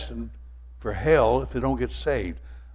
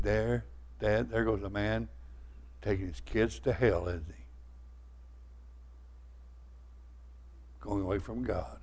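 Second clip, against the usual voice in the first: put-on tearful boy; 3 semitones lower, 11 dB quieter than usual. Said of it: "David said to me with tears".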